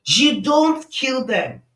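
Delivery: loud, high shout; sudden high-pitched shout of warning; dad's scream. Acted. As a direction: yell it at your son in alarm. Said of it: "He screamed".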